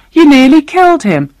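high, indignant protest; high-pitched outrage; outraged accusation. A narrator's reading. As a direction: accuse her in a high, appalled voice. Girl outraged at the boast.

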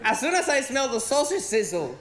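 normal talking voice

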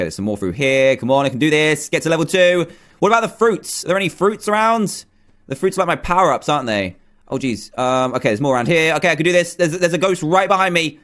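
normal talking voice

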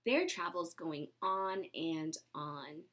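ordinary delivery

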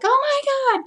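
high, loud mock squeal of excitement; excited game character. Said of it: "she's like".

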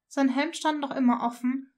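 ordinary delivery, nothing marked

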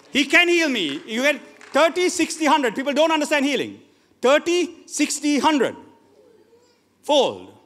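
high, loud declaration; fervent preaching. Real, not acted. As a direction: proclaim healing with full conviction. Preacher exclaims to congregation.

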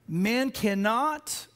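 slow, weighty "Man cannot"; "Man cannot" said slowly and emphatically; emphatic. Real, not acted. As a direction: draw out "Man cannot" with firm, deliberate stress on both words. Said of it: "I'm saying this emphatically".

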